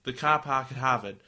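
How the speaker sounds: badly faked Boston accent; half-successful Boston R-dropping; attempted Boston accent. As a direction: test out a Boston accent, unsure. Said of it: "I can't do a Boston accent".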